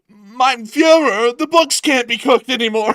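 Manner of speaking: high, very loud, slow panicked yell; panicked yelling accountant. Pretend. Accountant bursting in on Putin, shrieking in panic. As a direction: burst in shrieking bad news. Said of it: "yelling in Russian".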